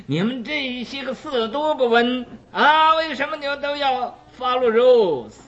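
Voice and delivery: high, ranting mock tirade; angry outburst. Scolding Buddhist students for keeping rules, mock angry rant. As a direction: throw a tantrum at the rule-keepers, half-acted. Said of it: "你大发脾气".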